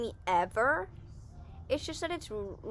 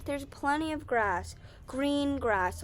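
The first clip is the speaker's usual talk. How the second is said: usual speaking voice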